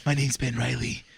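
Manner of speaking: low, brooding hero introduction; deep self-serious hero voice; overdramatic superhero. Acted. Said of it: "gonna say it like that too".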